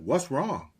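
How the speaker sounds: worried little girl, gently imitated; little daughter's voice. Daughter standing between dad and brother, light child voice. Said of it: "looked at me like".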